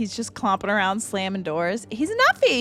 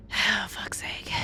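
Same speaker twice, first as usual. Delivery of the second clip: whisper; 2 semitones lower, 5 dB quieter, slower than usual. Real, not acted.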